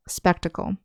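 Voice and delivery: "spectacle" with the stress on SPEC, the rest soft and quick. Shown as a demonstration. Teacher showing students "spectacle" stressed on SPEC. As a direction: lean on the first syllable of "spectacle". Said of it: "the accent is on the first syllable".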